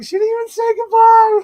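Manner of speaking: very high, loud squealed outcry; mock wail. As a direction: wail it out in hammed-up dismay. Acting out chat's dismay, first take.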